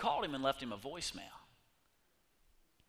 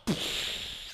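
gush noise. Acted. Water bursting from rock.

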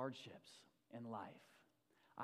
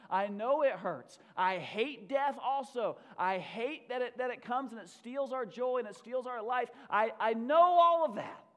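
loud, higher preaching in Jesus' words; fervent proclamation; 7 semitones higher, 11 dB louder than usual. Acted. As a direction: preach at full force, peaking in a shout. Preaches as Jesus, full force.

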